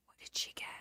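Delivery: hushed, breathless whisper; whispered nosy question. Retold or imitated. Relatives craning to see her present, whispering. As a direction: whisper it like a curious onlooker.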